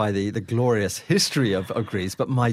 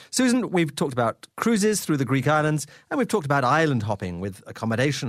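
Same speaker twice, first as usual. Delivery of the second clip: upbeat travel show host; 4 semitones higher than usual. Acted. Radio host opens segment.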